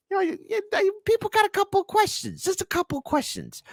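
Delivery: very high, squeaky put-on voice; mock-innocent falsetto. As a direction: jump up into falsetto, playing the innocent questioner. Feigns innocent skepticism in squeaky voice.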